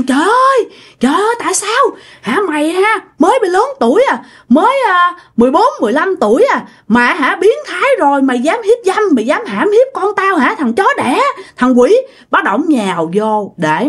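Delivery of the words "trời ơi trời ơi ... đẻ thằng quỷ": high, outraged scolding, not truly shouted; retold angry cursing. Uncle cursing the man he accuses, high acted outrage. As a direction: act out a furious man hurling curses. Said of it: "ổng chửi".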